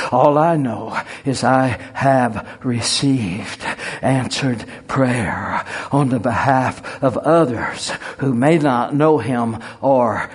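normal talking voice

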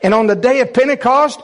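high, loud fervent preaching; preaching at full force. Acted. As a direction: preach it with authority and fire. Preaching the command to wait.

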